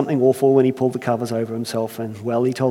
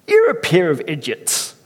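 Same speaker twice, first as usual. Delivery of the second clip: Irish brogue scolding; 3 semitones higher than usual. Acted. Earthmaker scolding first man in an Irish accent. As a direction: tut at two foolish children. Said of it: "I can't help but try it".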